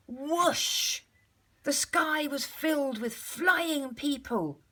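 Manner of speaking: ordinary delivery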